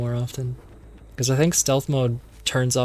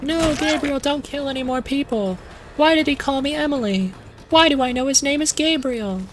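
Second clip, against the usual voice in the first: put-on much higher, panicky young woman's voice; mock frightened plea; 13 semitones higher than usual. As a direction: plead high and frantic as the scared heroine. Madison begs Gabriel on the phone.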